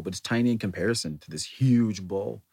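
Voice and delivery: ordinary talk, nothing marked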